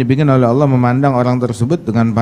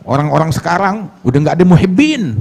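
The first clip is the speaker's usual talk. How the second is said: raised-pitch mock grumble; imitated complaint; 6 semitones higher than usual. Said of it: "Ada orang bilang".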